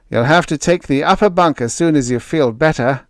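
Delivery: lightly voiced sailor's warning; friendly heads-up, a touch firm; mild warning. A narrator's reading. Moore warns Greg about bunks, mildly. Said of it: "Moore warned".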